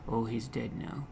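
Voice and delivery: mournful friend. Acted. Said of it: "some of the friends are saying".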